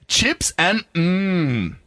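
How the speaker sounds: very slow, savouring mmm; drawn-out mmm. Retold or imitated. Reading the snack box slogan, stretched and slow. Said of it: "advertised on the side".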